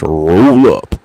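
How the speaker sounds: Transformers. Acted. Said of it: "Like Transformers".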